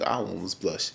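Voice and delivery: ordinary delivery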